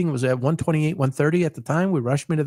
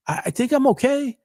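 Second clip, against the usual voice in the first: timid reply; 8 semitones higher than usual. Retold or imitated. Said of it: "That's what I'm saying".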